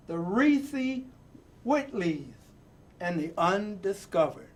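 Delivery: rhythmic, building reading with falling endings; dramatic poem reading. Acted. Host reading a picture book poem to young viewers, rousing. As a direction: read the poem like a rallying call. Reads The Undefeated poem aloud.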